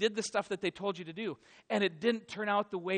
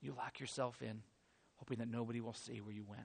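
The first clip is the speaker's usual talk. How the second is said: hushed despair; 7 semitones lower, 9 dB quieter than usual. Acted. Hiding in fear and grief.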